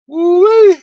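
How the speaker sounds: loud, high woo-wee of disbelief; excited whoop. Real, not acted. Vlogger reacting to the politician's nerve, loud high whoop. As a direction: whoop it out, half amazed, half laughing.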